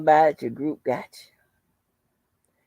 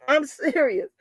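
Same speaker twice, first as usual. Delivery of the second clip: laughing; 6 semitones higher than usual. Real, not acted. Still laughing at the excuse.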